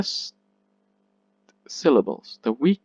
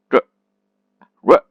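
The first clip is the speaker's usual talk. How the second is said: r as a very short, reduced ruh; weak and fast; 10 dB louder than usual. Shown as a demonstration. Teacher showing the unstressed syllable, r very short. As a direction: cut r off quickly with a tiny vowel. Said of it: "very weak and fast".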